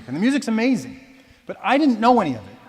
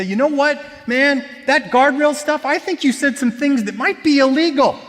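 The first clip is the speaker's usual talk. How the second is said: scolding lecture; 9 semitones higher, 6 dB louder than usual. Retold or imitated. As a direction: give him a piece of your mind, much higher and louder. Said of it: "give him a piece of my mind".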